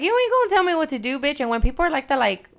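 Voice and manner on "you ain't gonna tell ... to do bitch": nasty retort. Retold or imitated.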